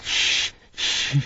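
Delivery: soft, voiceless shushing; hushing. A narrator's reading. Tigris soothing the crying little one, soft hushing. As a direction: shush gently under the breath.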